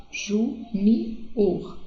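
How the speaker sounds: "junior" very slowly broken into JU - NI - OR; syllable by syllable. Shown as a demonstration. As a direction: separate each syllable of "junior" with a pause. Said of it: "a terceira sílaba". ("junior" bem devagar, sílaba por sílaba)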